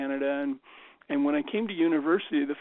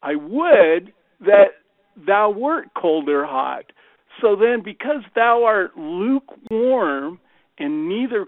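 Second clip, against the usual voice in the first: slow, weighty Bible quotation; measured, solemn declaiming of a verse; scripture recitation; 2 semitones higher, 4 dB louder, slower than usual. Acted. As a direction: recite scripture slowly and emphatically. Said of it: "there's this quote that says".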